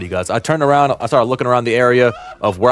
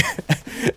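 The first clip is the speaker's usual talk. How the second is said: soft, high chuckle; short chuckle; 12 semitones higher, 8 dB quieter than usual. Real, not acted. Storyteller recalling his friend getting caught, amused chuckle. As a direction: let a small laugh slip out mid-story.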